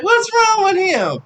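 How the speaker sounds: high, loud worried cry; alarmed neighbor. Retold or imitated. Neighbor shouting about the screaming boy, high and loud. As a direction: call out in alarm.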